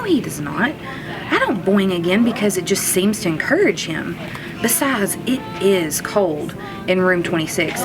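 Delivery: plain reading voice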